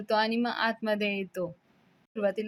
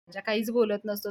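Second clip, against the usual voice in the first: ordinary delivery, nothing marked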